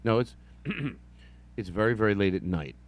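ordinary talk

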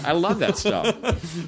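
laughing through the words, pitched high; high, drawn-out laughing delivery; real amusement. Real, not acted. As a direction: say it slowly through a delighted laugh. Amused after the guest's film pitch.

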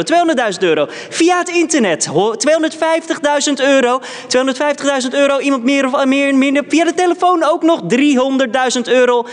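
high, fast auction calling; auctioneer voice. Pretend. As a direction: rattle off the bids like an auctioneer. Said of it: "mag ik eens proberen?".

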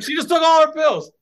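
very high, loud retold shock; over-the-top mock alarm; incredulous outcry. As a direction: squawk the news in disbelief. Replays the shocking news, worked up.

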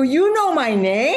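very high, slow incredulous question; thrilled disbelief; amazed laughing. Retold or imitated. Actress amazed Tracy Brightman knows her name, laughing.

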